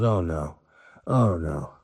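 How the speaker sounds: low, dropped groan of dismay; captain seeing a second pirate ship. Acted. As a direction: sink at the bad news. Captain sees another pirate ship.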